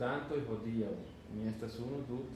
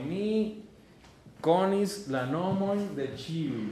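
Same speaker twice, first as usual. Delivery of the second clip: slow and louder, stressing konis; slow emphatic model; 5 semitones higher, 6 dB louder, slower than usual. Shown as a demonstration. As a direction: lay it out for the students. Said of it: "yo te voy a traducir". (lento y fuerte, marcando konis)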